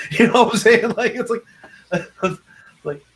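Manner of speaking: laughing at own joke mid-sentence. Real, not acted.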